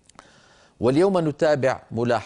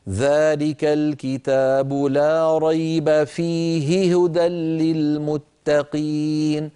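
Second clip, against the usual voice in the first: slow, chanted, louder tajweed recitation, fيه held; Quran recitation; 3 semitones higher, 3 dB louder, much slower than usual. Shown as a demonstration. Teacher reciting the verse for students, chanted slowly.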